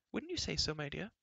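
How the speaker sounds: high coaxing question to her husband; fussy queen. A narrator's reading. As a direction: seek his agreement sweetly. Queen asks the king.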